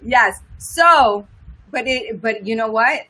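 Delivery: ordinary talk, nothing marked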